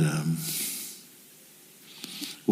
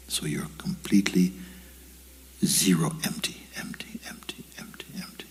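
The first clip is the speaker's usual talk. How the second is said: guided meditation; 5 dB quieter than usual. Acted. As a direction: let each word sink in softly. Guides listeners to empty the mind.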